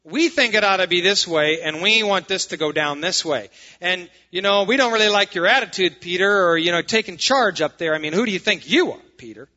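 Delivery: loud, hammed-up challenge to authority; mock indignant complaint. Imagined church member challenging Peter's leadership, mock indignant complaint. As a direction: push back loudly, acting out an affronted member. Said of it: "No record of anybody going".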